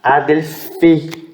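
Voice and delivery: Adelphi said slowly with the stress moved; slow teaching model of Adelphi; slow model word. Shown as a demonstration. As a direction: draw out Adelphi. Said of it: "o que que eu vou fazer".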